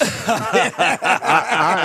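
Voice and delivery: laughing start of a reply. Real, not acted. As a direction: burst of laughter, high. Laughing at the rule.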